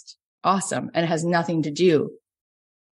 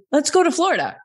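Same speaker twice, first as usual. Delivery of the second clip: bright, much higher, eager "let's go"; eager suggestion; 10 semitones higher, 3 dB louder, faster than usual. Retold or imitated. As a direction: pitch it up, excited to take him home. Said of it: "And I was like".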